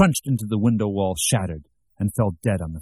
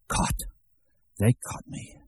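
soft, half-whispered panic of being found out; hushed dread; 6 dB quieter than usual. A narrator's reading. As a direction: whisper it to yourself as if caught. Caught out, panicked inner voice.

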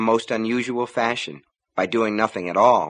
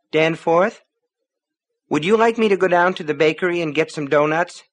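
calling through a door; 6 semitones higher, 4 dB louder than usual. A narrator's reading. Wife calls through the door.

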